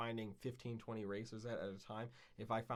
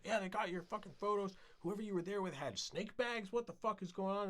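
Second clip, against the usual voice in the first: agitated friend; 10 semitones higher than usual. Retold or imitated. Kevin voiced upset.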